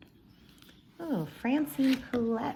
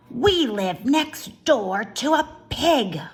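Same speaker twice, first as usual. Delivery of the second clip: put-on louder, slow, haughty older sister; 4 semitones higher, 8 dB louder, slower than usual. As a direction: declare it slowly like a proud older sister. Eugenia voiced in read-aloud.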